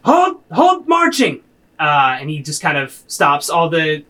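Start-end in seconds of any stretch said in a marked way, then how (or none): 0.0-1.4 commanding shout. Acted. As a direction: bark a loud halt order. Commander halts marching soldiers.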